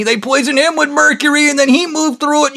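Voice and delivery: very high, mocking explanatory voice; Blondie explaining. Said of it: "Blondie was explaining to me".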